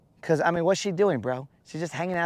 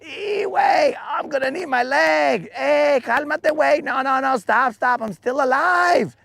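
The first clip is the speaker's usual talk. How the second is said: high, loud panicked bug voice, pleading in Spanish slang; desperate high yelling bug; frantic dying bug; 11 semitones higher, 3 dB louder than usual. Pretend. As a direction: squeal and beg like a bug losing its leg. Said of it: "I'd be like".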